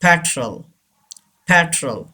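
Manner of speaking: "petrol" ending in an unstressed -trul; correct pronunciation. Shown as a demonstration. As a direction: say "petrol" with a short schwa in the last syllable. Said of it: "Say this word". ("petrol" का सही उच्चारण, अंत में हल्का "ट्रल")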